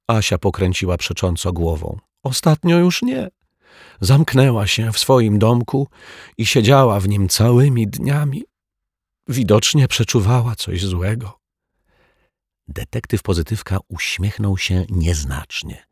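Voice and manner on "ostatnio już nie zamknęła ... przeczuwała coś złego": put-on higher, childlike and plaintive; a child demanding who hurt her pet; upset little girl. A narrator's reading. Asia asks the detective who did it, high plaintive child voice.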